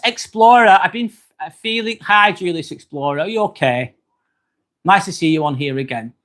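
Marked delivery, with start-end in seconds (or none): none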